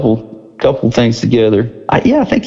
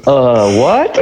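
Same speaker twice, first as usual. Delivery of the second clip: mock bewilderment, pitched up; baffled. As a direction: imitate someone thrown by an odd remark. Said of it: "long, laughing awkward pause".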